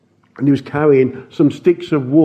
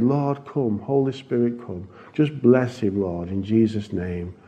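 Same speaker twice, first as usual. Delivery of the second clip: quiet prayer; 4 semitones lower, 6 dB quieter than usual. Retold or imitated. Praying over the frail man.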